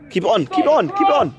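sideline shout. Real, not acted. Parent shouting at match.

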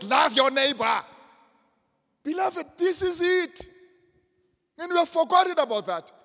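high, ringing pulpit cry with shouted peaks; full-force preaching. Acted. Preacher correcting pastors on what loving God means, full-force preaching. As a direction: drive it home to the whole congregation.

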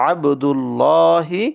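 আবদুল্লাহি slow, thick lam; heavy lam recitation. Shown as a demonstration. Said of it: "এটাকে পড়তে হবে".

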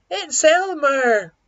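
high, loud, drawn-out cry of recognition; storybook shout of discovery; crowd's shout. A narrator's reading. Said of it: "they shouted".

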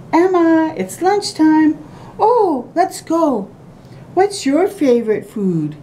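put-on lifted, brighter storybook girl voice; girl character voice. Reading the book's girl asking about lunch, lightly voiced. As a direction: read the girl's lines up high. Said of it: "It says".

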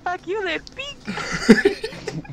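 high robotic console voice; Xbox voice. Retold or imitated. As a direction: squeak it like an angry machine. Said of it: "He's gonna be like".

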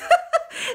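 a short high burst of laughter. Real, not acted. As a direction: break into a quick giggle.

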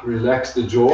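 ordinary talk, nothing marked

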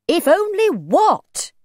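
high, loud, slow question rising at the end; suspicious demand. A narrator's reading. Mother demands the old man finish, suspicious. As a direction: throw it back at him sharply.

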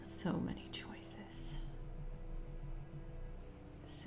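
breathy slow whisper to herself; hushed, drawn-out whisper. Real, not acted. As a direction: whisper it, slowly weighing the options. Mulling a game choice.

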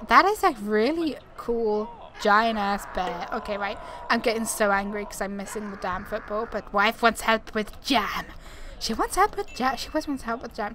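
usual speaking voice

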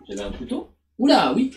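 ordinary delivery

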